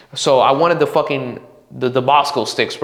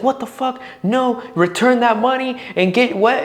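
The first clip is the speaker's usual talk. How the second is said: imitating mad mom; 9 semitones higher than usual. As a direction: play an outraged mother, pitched way up. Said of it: "she got so mad at me".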